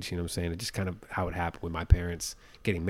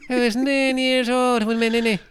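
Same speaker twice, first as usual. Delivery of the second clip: very high, loud hammed-up Irish lad voice; mock Irish accent; 14 semitones higher, 6 dB louder than usual. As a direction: squeal it like a wee Irish boy. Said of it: "Or maybe he's like".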